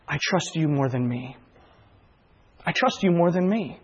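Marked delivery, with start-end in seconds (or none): 0.1-1.4 quiet prayer. Retold or imitated. Repeated prayer line.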